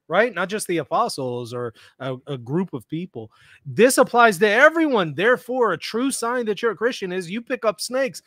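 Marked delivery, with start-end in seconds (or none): 3.7-8.2 mocking preacher. Voicing snake handlers' belief.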